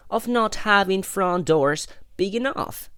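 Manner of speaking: high-pitched, indignant grumble; storybook crossness, lightly played; cross. A narrator's reading.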